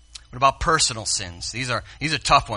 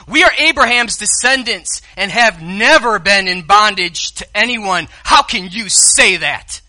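mock angry Pharisees; 7 semitones higher, 9 dB louder than usual. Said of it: "really mad".

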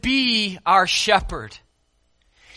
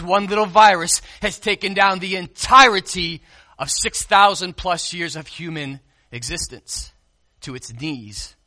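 normal talking voice

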